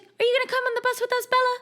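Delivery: very high, fast sweet voice; adorable Alice. Retold or imitated. Alice inviting Bella onto the bus, high and sweet. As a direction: chirp it like a cute little girl. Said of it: "Alice is so adorable".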